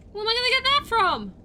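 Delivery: loud, high exasperated cries; frantic high complaint; panicked yelling. Real, not acted. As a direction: wail it out in frustration. Player panicking over quest.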